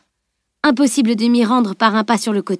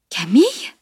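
stunned question sweeping steeply upward; incredulous, sharply rising query; astonished. A narrator's reading. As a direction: gasp the name in disbelief. Startled mother recognises Camille.

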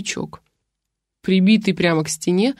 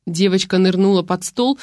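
plain reading voice

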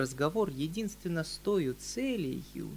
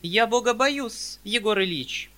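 high, louder prim spinster with wounded dignity; high, louder haughty spinster voice; dignified spinster; 6 semitones higher, 7 dB louder than usual. Acted. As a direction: stand on your dignity as the old maid. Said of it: "с достоинством отвечала".

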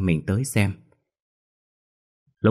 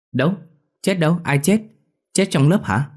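high, quick alarmed questions; a boy jumping at the word dead, high and alarmed; startled kid; 10 semitones higher, 3 dB louder than usual. Acted. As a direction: fire off questions in surprise.

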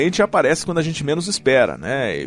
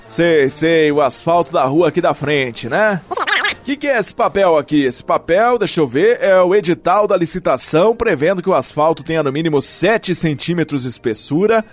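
showy circus ringmaster, higher; 5 semitones higher than usual. Acted. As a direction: announce like a big-top ringmaster. Plays circus ringmaster announcing.

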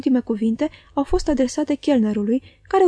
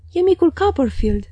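surprised cry; 5 semitones higher than usual. A narrator's reading. Old schoolfriend recognizes young Copperfield, crying out with joy. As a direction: pitch jumps high on a happy recognition. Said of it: "a strigat el deodată".

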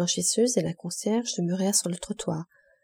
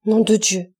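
a choked curse as he slips, loud; falling man's strangled oath; stifled oath; 7 dB louder than usual. A narrator's reading. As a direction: cry out in sudden terror. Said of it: "dit-il d'une voix étouffée".

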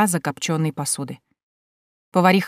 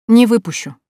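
plain reading voice

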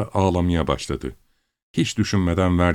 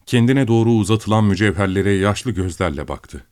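ordinary delivery, nothing marked

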